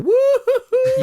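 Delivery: high, slow joyful woo-hoo scream; happy dog whooping, drawn out. Acted. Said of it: "a dog just screaming through the park going".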